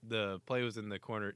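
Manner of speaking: usual speaking voice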